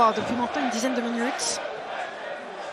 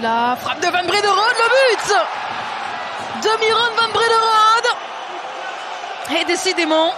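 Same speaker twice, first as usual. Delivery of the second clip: excited goal call; 2 semitones higher, 3 dB louder than usual. Real, not acted. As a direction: shout the goal out with joy. Van Brederode goal call. (annonce de but criée)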